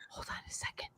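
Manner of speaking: breathy whisper aside. Real, not acted. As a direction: drop to a whisper.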